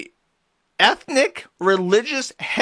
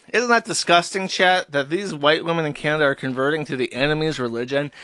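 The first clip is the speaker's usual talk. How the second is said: usual speaking voice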